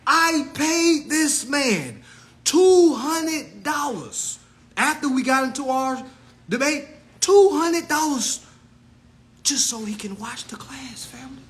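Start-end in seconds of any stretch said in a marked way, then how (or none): 0.1-8.4 higher, slower, every word hammered; slow emphatic repeat. Shown as a demonstration. Repeating it for viewers who missed it, slow and emphatic. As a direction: spell it out for them. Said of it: "I'm gonna say it one more time".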